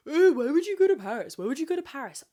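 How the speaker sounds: high, loud mocking whine; mocking sceptical friends. Mocking people who question her love of Paris, in a high loud sneer. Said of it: "people that were like".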